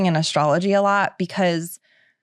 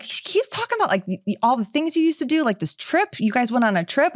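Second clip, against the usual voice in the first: higher, eager channeling voice; imitating gushing psychic; 6 semitones higher than usual. Medium relaying Steve's message, higher excited voice. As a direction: pass on the spirit's message brightly. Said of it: "she's like".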